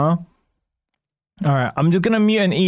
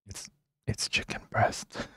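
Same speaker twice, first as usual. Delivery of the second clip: whisper; 14 dB quieter, slower than usual. Real, not acted. Eating while streaming, whisper.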